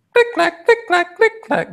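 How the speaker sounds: very high squeaky mill noise; tar monster. Acted. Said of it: "that's a shitty mill sound".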